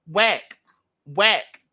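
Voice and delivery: two loud punchy whacks. Real, not acted. Vlogger slamming her music with loud punchy whacks.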